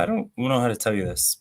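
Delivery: ordinary delivery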